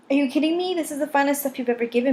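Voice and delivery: high, delighted disbelief; excited. A narrator's reading. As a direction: gush over the new gear. Bergman delighted by the gear.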